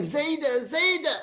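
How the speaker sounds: high-pitched imitation of a child's scream; mock scream. Grandson feigns fingers stuck in the door, high mock scream. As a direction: cry out high for help, only half loud. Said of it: "he starts screaming".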